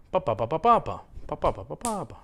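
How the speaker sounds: rhythmic "ba ba ba", one beat stressed; sentence rhythm in "ba". Shown as a demonstration. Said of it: "let's try it again".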